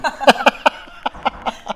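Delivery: breathy high laugh; a quick unvoiced laugh after the joke. Real, not acted. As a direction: let a real laugh burst out.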